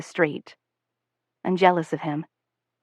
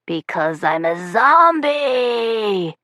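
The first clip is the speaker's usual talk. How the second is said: slow, drawn-out zombie voice; hammed-up monster growl at the little boys; 3 semitones higher, much slower than usual. A narrator's reading. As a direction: stretch it out like a lurching zombie. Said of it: "in my best monster voice".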